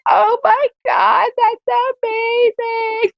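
very high, slow, squealing "oh my God"; gushing amazement. Retold or imitated. Retelling her reaction to a colorblind man seeing colour, squealing amazed. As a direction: swoon over the colorblind man's sunset video. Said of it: "And I was like".

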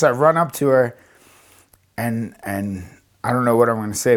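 ordinary delivery

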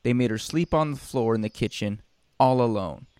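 ordinary delivery

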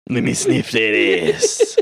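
slow, drawn-out Brooklyn character voice; Brooklyn dwarf. Acted. Dwarf character saying his catchphrase, slow put-on Brooklyn voice. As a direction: draw it out as a comic Brooklyn dwarf. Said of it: "I played, like, a Brooklyn dwarf".

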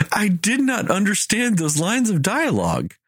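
ordinary delivery

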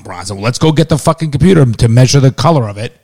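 ordinary talk, nothing marked

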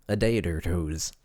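mock Wisconsin accent on long o. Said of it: "like I'm from fucking Wisconsin".

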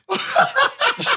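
very high, loud burst of laughter; piercing real cackle. Real, not acted. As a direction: explode into a shrieking laugh. Cracks up at his own blunder.

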